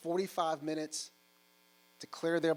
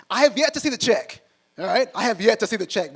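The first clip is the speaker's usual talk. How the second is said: loud and much higher, hammering the joke; emphatic shout; 7 semitones higher, 14 dB louder than usual. Real, not acted. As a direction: belt it out to the crowd. Punchline about unpaid debt.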